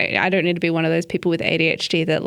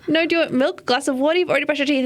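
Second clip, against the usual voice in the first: put-on high, insistent offer to a toddler; pleading babysitter; 8 semitones higher, faster than usual. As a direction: reason with a child in a raised pitch. Babysitter reasoning with child, retold.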